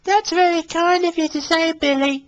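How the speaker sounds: high, gentle woman's voice; high, kind thanks; smiling Mary Marvel. Acted. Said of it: "smiled Mary".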